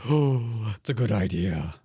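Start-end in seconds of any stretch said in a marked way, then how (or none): none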